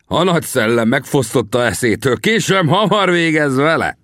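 high, swaggering boast to onlookers; boastful taunt. A narrator's reading. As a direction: crow it as the voice climbs. Warrior boasts before knife duel.

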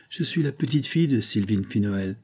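ordinary delivery, nothing marked

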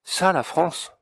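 high scornful scoff at the map; a high, disdainful exclamation; deep disdain. A narrator's reading. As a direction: sneer out the words in deep contempt. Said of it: "avec un profond dédain".

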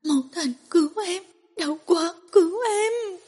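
sobbing cry of pain; tearful plea. Acted. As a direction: beg through tears, climbing in pitch. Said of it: "nước mắt chảy ra".

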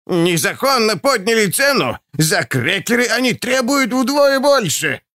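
put-on high-pitched outraged old crone; shouting old woman. A narrator's reading. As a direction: cry out in protest, voice climbing high. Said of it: "кричала какая-то тощая старуха".

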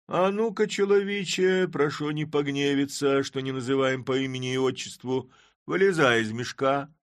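high, loud mock courtesy; mock-polite Chub. A narrator's reading. Chub mockingly inviting the man out, high and loud. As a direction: coax the sack with irony.

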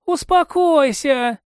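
high, slow, strained voice; Rao croaking. A narrator's reading. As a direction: stretch it out through a hurt throat. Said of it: "её голос вернулся к нормальному состоянию".

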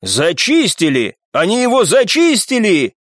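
amazed exclamation; astonished shout. A narrator's reading. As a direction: call it out in amazement, rising on the repeat. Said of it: "изумлённый возглас одного из".

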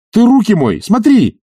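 high, loud, irate outburst; angry scolding. A narrator's reading. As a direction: snap crossly at a servant. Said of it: "злобно сказал Обломов".

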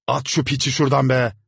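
loud, high enraged yell; furious shout. A narrator's reading. Landlord red with fury shouting at the servant. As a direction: bellow the order in rage. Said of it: "hırsından kıpkırmızı kesilerek bağırdı".

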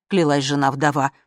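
usual speaking voice

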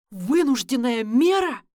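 loud, shaking disbelief; voice breaking in anger. Acted. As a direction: throw the words back, nearly breaking. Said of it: "Джеймс почти срывается".

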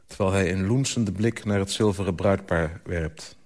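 usual speaking voice

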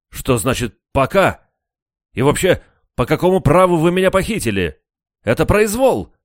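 high, loud indignant protest; bristling, outraged prisoner; indignant outburst. A narrator's reading. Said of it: "вскинулся Красин". (возмущённый возглас)

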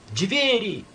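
high sharp cry; alarmed cry. A narrator's reading. Elena cries out to the men about the doors. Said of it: "крикнула Елена".

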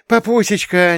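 put-on second girl squeals just as high; little girl shrieking for her father; squealing child. A narrator's reading. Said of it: "не отставала Юля".

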